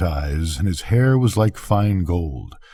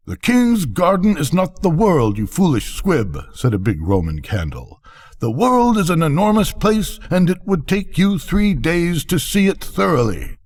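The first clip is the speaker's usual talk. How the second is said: much higher, haughty firework voice; scolding Roman candle; 9 semitones higher than usual. A narrator's reading. Big Roman candle scolding the squib, high and haughty. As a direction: lecture the foolish squib from above.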